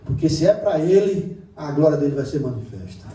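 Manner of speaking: loud, high, fervent preaching; full-force preaching. Acted. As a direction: preach it out to the congregation. (pregação inflamada, em voz alta)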